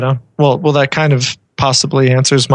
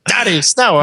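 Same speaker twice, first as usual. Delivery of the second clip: lightly imitated child, pitched higher; mock excited toddler; 6 semitones higher than usual. Co-host jokingly echoes the guest's daughter, in mock toddler excitement. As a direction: playfully mimic a little kid's excited call.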